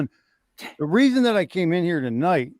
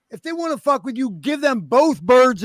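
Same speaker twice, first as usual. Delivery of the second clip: defiant; 7 semitones higher, 4 dB louder than usual. Real, not acted. Defiant advice to the chat.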